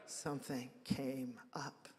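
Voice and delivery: quiet, slow knowing repeat of Something came up; wry echo. Shown as a demonstration. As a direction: let Something came up hang quietly, drawn out and ironic.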